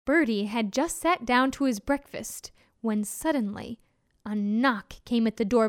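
plain reading voice

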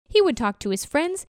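ordinary delivery

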